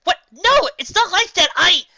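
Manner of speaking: high, loud flustered protest; defensive stammer. A narrator's reading. Dash to Gilda, denying a crush, defensive stammer. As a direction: stammer it out, caught off guard. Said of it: "Dash stammered defensively".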